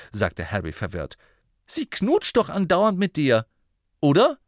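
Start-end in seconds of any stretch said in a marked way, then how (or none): 1.7-4.4 high, loud disbelieving question; rising sharply in disbelief; incredulous. Acted. Harry baffled, asking Ron in loud disbelief.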